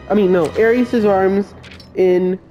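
ordinary talk

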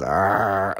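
low drawn-out 'arr'; imitated dog growl. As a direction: growl like a pet over a toy. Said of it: "when Mocha goes".

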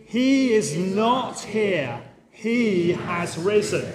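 very slow, drawn-out group declaration; congregational recitation. Shown as a demonstration. Preacher leads congregation and children saying it together, slow recitation. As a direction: lead the room word by measured word. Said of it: "Let's say this together".

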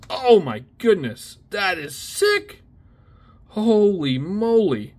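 high and loud, stretching each word in amazement; awestruck excitement. Real, not acted. Opening a pack and finding a prized card, awestruck and excited. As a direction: gasp it out like you can't believe your luck.

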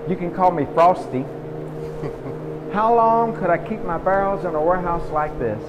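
usual speaking voice

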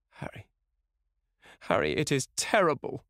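anguished cry. A narrator's reading. Dorian cries to Harry over Sybil's death, anguished. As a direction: start faint, then cry out in horror. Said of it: "cried the lad".

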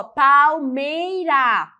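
"palmeira" slow and loud, syllable by syllable; split into syllables. Shown as a demonstration. Teacher counting syllables, "palmeira" slowly broken into three. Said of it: "com a separação silábica gramatical".

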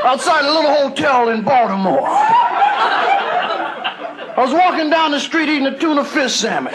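high, slow preacher telling his calling. Retold or imitated. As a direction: preach it like an old-time reverend.

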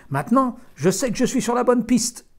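loud, high call from a distance. A narrator's reading. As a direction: call across the woods. Farmer calling from woods.

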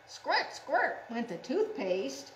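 ordinary delivery

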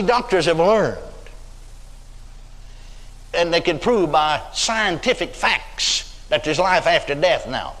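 usual speaking voice

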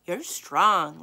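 called out loud to someone nearby; calling out. Acted. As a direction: raise the voice to reach her. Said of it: "Lainey called".

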